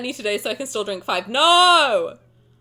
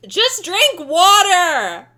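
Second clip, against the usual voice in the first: slow, loud, pleading; emphatic plea; 4 semitones higher, 7 dB louder, much slower than usual. Real, not acted. Streamer begging chat to skip alcohol, slow emphatic plea. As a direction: drag each word out to insist. Urging chat to drink water.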